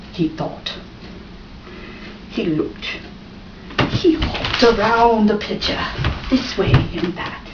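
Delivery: usual speaking voice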